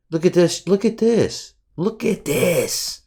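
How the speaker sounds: disbelieving whine at a messy market; exasperated high "look at this". Real, not acted. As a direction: groan it at the screen, rising then slowing. Exasperated at choppy chart.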